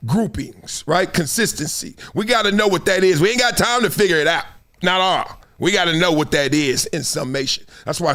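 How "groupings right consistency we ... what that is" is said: normal talking voice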